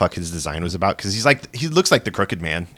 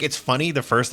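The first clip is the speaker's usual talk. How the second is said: normal talking voice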